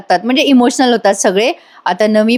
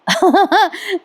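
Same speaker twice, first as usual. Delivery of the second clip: high, breathy giggle at the scene; real giggle; 8 semitones higher than usual. Real, not acted. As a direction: let a real laugh slip out. Narrator laughs at the scene.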